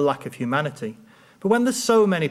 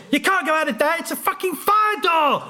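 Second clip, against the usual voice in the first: high, mock-furious bouncer yelling through a laugh; laughing while imitating an irate bouncer; angry bouncer; 13 semitones higher than usual. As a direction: ham up the outraged doorman.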